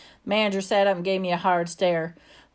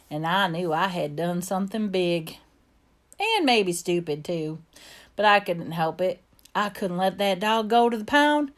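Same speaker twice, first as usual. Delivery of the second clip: put-on Southern accent. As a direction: narrate like a child from the American South. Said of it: "I get to use my Southern accent".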